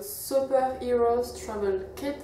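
put-on slow, careful English accent on the brand name. Vlogger names the British kit in an English accent. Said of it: "Voilà mon accent anglais".

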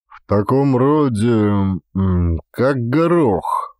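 ordinary delivery, nothing marked

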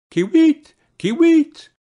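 high, loud chirping bird cry; bird call. Acted. The bird announcing itself from the roof, high and loud. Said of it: "and sang".

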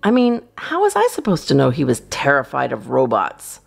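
ordinary delivery, nothing marked

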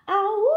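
high, loud howl of pain; drawn-out yowl; wolf's howl. Acted. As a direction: howl it out long as a hurt wolf. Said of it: "howled that wolf".

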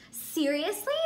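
ordinary delivery

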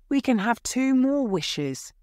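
high, excited exclamation; crying out. A narrator's reading. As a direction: burst out with it, pitched high. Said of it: "she cried".

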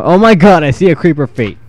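startled. Real, not acted. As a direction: break off in alarm at a monster. Startled by monster in game.

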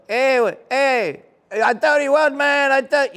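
high, loud Mexican-American buddy voice; George Lopez impression. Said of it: "George Lopez talked to you like this".

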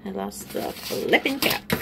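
ordinary talk, nothing marked

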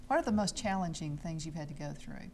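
ordinary talk, nothing marked

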